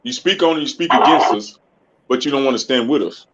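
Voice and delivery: ordinary delivery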